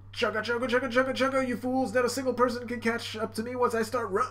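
high, chugging cartoon voice; boastful runner. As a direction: chant like a train, then gloat. Runner boasts while fleeing.

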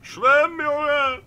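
high panicked yell; screaming in real panic; panicking gamer. Real, not acted. Shark attacks while swimming.

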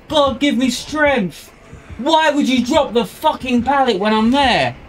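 high-pitched, exasperated outburst; exasperated rage. Real, not acted. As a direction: berate a useless teammate, voice pitched high. Berating teammate over dropped pallet.

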